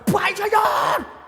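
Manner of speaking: high, long-drawn holler; spluttering yell. Acted. Worker straining to reach the engineer, yelling hard. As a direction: shout so hard the spit flies. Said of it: "Sampai ludahnya kemana-mana".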